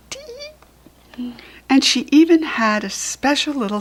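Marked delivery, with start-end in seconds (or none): 0.1-1.3 very high, very soft peep; a baby bird's faint tee; tiny bird peep. Acted. Tiny bird chiming in, very high soft peep.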